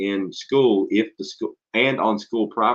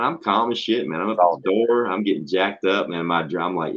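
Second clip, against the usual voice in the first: ordinary talk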